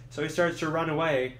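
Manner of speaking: ordinary talk, nothing marked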